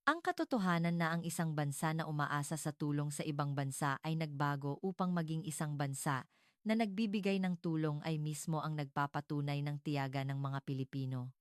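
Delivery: usual speaking voice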